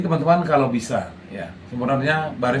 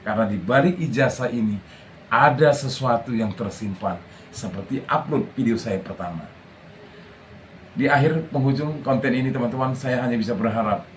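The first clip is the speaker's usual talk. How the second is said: ordinary talk, nothing marked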